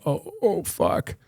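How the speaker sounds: hushed, retold panic, starting high; panicked swearing under the breath, played lightly; mock panic. Actor reliving forgetting his line onstage, quiet mock panic. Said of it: "there's that panic of like".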